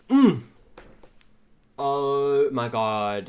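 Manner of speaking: high, slow, drawn-out swooning hum; dreamy swoon. Real, not acted. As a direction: melt over him. Swooning over handsome character.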